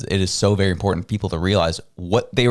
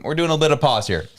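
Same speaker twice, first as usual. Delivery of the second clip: usual speaking voice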